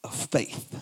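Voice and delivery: whispered softly. Acted. Pastor to congregation, whispered. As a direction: breathe the words.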